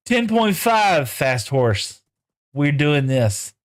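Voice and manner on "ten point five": excited count. Real, not acted. Horse hits top speed.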